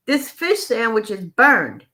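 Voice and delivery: slow, firm complaint with weight on fish; declaring. Retold or imitated. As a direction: lay out the complaint deliberately and slowly. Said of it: "he declared to the waitress".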